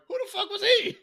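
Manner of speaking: high, loud, laughing outburst of disbelief. Retold or imitated. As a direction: shriek it out through laughter, floored. Stunned reaction, retold.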